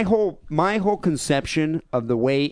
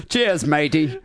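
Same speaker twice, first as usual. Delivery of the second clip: mock English accent; 3 semitones higher, slower than usual. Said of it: "I think that was Australian".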